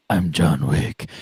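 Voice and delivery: low, flat movie hero voice; deadpan action star line; John Wick line. Retold or imitated. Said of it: "turn and say".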